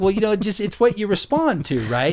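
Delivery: mock gentle coaxing of a neighbor; coaxing suggestion. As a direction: nudge him gently toward the idea. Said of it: "I'll try and, like".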